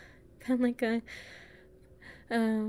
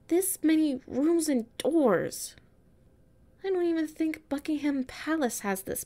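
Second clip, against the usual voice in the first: louder, higher exasperated complaining; fed-up grumbling, raised; frustrated outburst; 4 semitones higher, 5 dB louder than usual. Acted. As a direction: vent at the endless castle. Lost in endless castle, frustrated.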